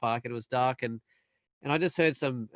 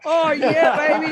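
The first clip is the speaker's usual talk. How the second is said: loud, much higher gloating whoop with a laugh; mock triumphant cheer; 13 semitones higher, 10 dB louder, slower than usual. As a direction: crow with delight at the lucky break. Retold joy at Russian's delay.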